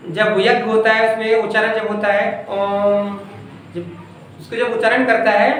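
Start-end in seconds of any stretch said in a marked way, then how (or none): 2.5-3.2 ओम held long for three beats; ओम with a long, sustained sound; drawn-out ओम. Shown as a demonstration. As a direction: stretch out ओम like a Vedic chant. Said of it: "तीन मात्रा का समय लगता है".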